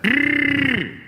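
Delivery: high, strangled growl; imitated growl. Woman on the subway growling, high imitated growl. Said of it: "I heard this sound".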